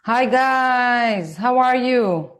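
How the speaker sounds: ordinary delivery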